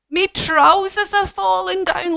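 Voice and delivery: high mock-panicked wail; alarmed giant. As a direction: cry it out in playful alarm. Giant's trousers falling.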